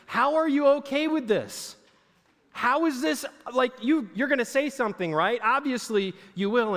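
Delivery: mock outrage, demanding an answer; upset pleading. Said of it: "she gets so upset that she tells Jesus".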